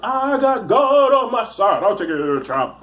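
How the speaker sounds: hammed-up zealot, starting high and slow; mock holy boast that tumbles into a mumble; self-righteous believer. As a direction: caricature a cleric proclaiming himself. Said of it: "anybody who's like".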